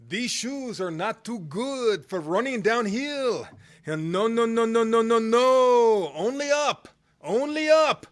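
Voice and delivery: put-on thick French accent. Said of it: "in his thick French accent".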